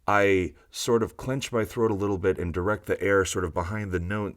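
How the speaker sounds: ordinary talk, nothing marked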